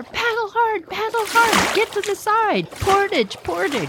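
high, urgent shouted warnings; urgent calling. Retold or imitated. Girls ahead call back to the others, high urgent shouts. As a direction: yell the warnings to the canoes behind.

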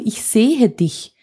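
„Ich sehe dich“ said very slowly, stress on sehe; lingering, unhurried I see you; slow gentle acknowledgement. Shown as a demonstration. To an angry partner, slow and acknowledging. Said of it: "sondern nur".

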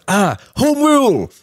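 imitating an old man's high, loud cry of recognition; delighted cheer. Old Sudanese man realising the speaker is Irish, delighted high shout.

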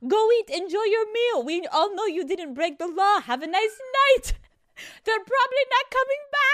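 very high, hammed-up British manager; attempted British accent. London manager reassures the guests, silly British accent. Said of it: "What is this accent?".

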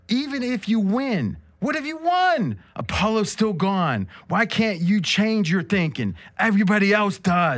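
high, rising Adrian plea. Acted. Adrian begging Rocky to change, high. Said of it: "I'm stuck with Adrian".

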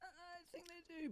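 tiny, high, drawn-out hedge; sheepish admission. Real, not acted. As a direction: admit it very softly and slowly, pitched high. Sheepishly admits fish kissing.

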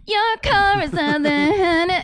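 high, slow mocking lyric voice; mock singer. Mocking the song's lyric to cohost, high and slow. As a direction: parody the clunky lyric.

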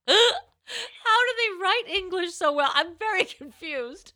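cracking up while reading aloud, high-pitched; laughing. Real, not acted. Host reading comments from someone in Indonesia, laughing hard. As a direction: barely get the words out for laughing. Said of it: "laughing about is the comments".

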